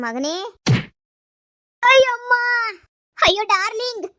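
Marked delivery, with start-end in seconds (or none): 1.8-2.3 high shrieking ow; pained scream. Acted. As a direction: scream as if hit. Mistress being beaten.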